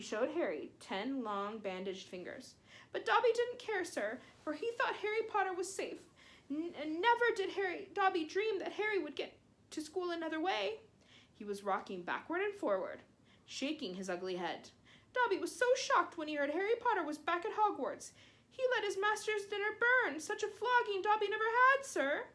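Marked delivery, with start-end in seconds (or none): 2.9-10.8 high, squeaky elf voice, begging; a small elf scolding his hero; pleading house elf. Acted. 15.1-22.3 high, squeaky elf voice, begging; a small elf scolding his hero; pleading house elf. Acted.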